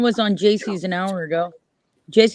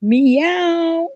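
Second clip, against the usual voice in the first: higher, drawn-out meow like a cat; high playful kitty meow; 7 semitones higher than usual. Acted. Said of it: "has a kitty accent".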